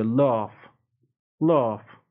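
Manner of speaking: British "Laugh" with an open back AH; British broad-A "Laugh"; British pronunciation. Shown as a demonstration. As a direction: say "Laugh" as lahf, British style. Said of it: "این تو بریتانیایی هم".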